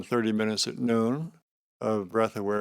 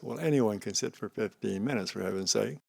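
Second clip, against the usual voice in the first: normal talking voice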